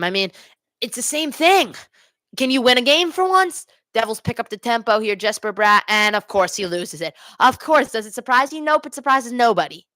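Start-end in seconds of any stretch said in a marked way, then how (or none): 0.8-3.7 high, pleading fan frustration; exasperated plea. Real, not acted. Fan pleads with losing team. 7.4-9.8 raised-pitch, bitter rhetorical question; mock your own team, fed up; sarcastic exasperation. Real, not acted. Fan sarcastic after team loses puck.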